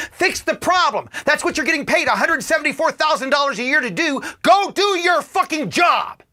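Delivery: high, loud furious yelling, slowing on the last words; pretend scream at congressman. Demanding his congressman do his job, furious shout. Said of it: "scream in somebody's face".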